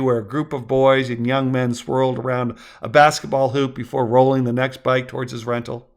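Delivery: ordinary delivery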